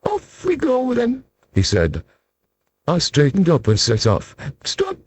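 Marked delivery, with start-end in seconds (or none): 0.0-1.2 small and polite from down below; tiny voice. Acted. Mister Small speaks. 4.6-5.0 much higher sudden small cry; a tiny sharp stop. Acted. Tiny Mister Small calls a sudden halt, high. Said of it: "cried Mister Small suddenly".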